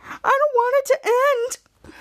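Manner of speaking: high, slow whiny wail; imitated crybaby lament; mock crying. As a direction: whine tearfully, putting it on. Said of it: "then Jasper cries".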